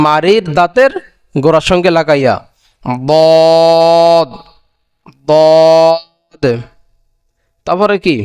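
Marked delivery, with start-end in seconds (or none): 3.1-6.4 দদ drawn out from the tongue's edge; Arabic letter demo. Shown as a demonstration. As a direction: say দদ slowly, tongue edge to molars. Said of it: "জিহ্বার গোড়ার কিনারা ... লাগাইয়া".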